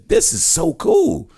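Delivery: imitating a child gasping at the big screen; amazed kid. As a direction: squeal it like a delighted little kid. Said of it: "or don't remember the cartoon and say".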